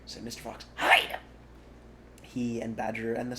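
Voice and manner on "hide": louder, urgent; urgent hiss. A narrator's reading. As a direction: order them to hide now.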